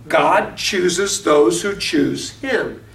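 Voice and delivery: each word laid down slowly and firmly; teacher stressing every word of "God chooses those who choose him"; slow emphatic spelling out. Shown as a demonstration. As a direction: drive the phrase home word by word, landing on "him". Teacher spells out the key phrase.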